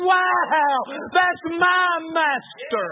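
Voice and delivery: high, loud, slow cry of wonder; awestruck cry. Retold or imitated. Lying under the stars praising God, loud slow awestruck cry. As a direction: shout it out, stretched and amazed. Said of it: "with tears rolling down my face thinking".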